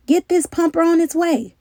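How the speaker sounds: high, loud command; barked order. A narrator's reading. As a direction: push the men into action. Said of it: "the captain ordered".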